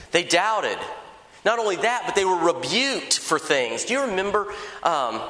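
ordinary delivery

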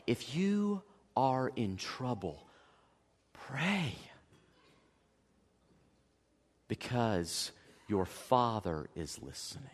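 hushed, slow, tender reassurance; soft pastoral appeal. Real, not acted. Preacher comforting the struggling congregation, hushed. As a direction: lean in and comfort them quietly.

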